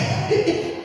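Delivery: a quick airless chuckle; self-mocking chuckle. Real, not acted. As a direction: laugh under the breath at himself. Laughs at own joke.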